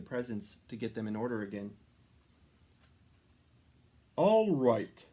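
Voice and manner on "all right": louder, hearty Santa; jolly Santa. A narrator's reading. Santa rallying the reindeer, hearty. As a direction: announce it heartily. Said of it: "said Santa".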